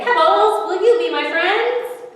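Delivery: the lonely fish calls to the pebbles; calling out. A narrator's reading. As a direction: shout the question across. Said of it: "I say".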